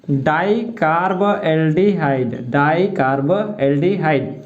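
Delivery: drawn-out डाइकार्बोल्डिहाइड, as if for note-takers; half-speed डाइकार्बोल्डिहाइड in distinct chunks; piece-by-piece dictation. Shown as a demonstration. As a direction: slowly separate each part of डाइकार्बोल्डिहाइड. Teacher dictates the term in pieces.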